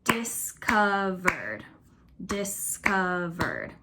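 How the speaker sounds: discovered said very slowly, syllable by syllable; slow syllable count for the class; clapping out syllables. Shown as a demonstration. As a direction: beat out "Discovered" slowly, one clap per syllable. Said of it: "let's see how many syllables it has".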